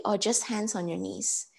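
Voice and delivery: normal talking voice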